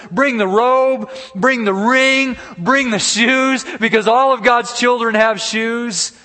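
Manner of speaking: high, loud, stretched commands; exultant calling out. Acted. Father sees his lost son coming home, jubilant loud orders. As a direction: call out slowly and ringingly to the servants.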